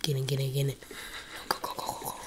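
urgent hushed whisper; tense breathless whisper. Real, not acted. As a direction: whisper so she can't hear you. Hiding from monster in game.

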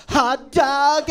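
exaggerated, drawn-out American "hot dog"; American accent. Comic getting back at Paul, overdone American accent. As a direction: overdo the American accent, stretching each word. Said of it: "That's my American accent".

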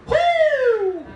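high, loud whoop of emotion; overwhelmed woo. Real, not acted. Recalling the night in jail, high loud whoop. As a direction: let it burst out like a holler at church.

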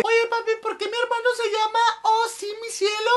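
put-on child's voice. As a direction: ask like a curious kid. Child asking dad.

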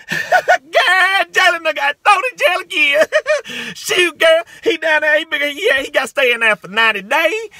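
high, loud gossiping hoot; amused auntie impression. Auntie telling mama about Jalen's jailing, high hammed-up glee. Mimics women on Jalen jailed later.

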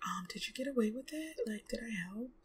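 whispering sibling; soft, unvoiced secret question; whispered. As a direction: hush it like a conspirator. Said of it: "Morgan's like".

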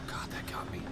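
whispered after a fright; breathless whisper. Real, not acted.

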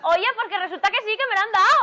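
high, loud excited reply; excited news. Retold or imitated. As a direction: burst out with the good news. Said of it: "la llamo por teléfono".

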